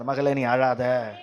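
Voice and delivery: soft, low, slowing to gentle comfort; hushed reassurance to a grieving daughter; tender consolation. Retold or imitated. Voicing Jesus consoling weeping mother. (மென்மையான, ஆறுதலான தாழ்ந்த குரல்)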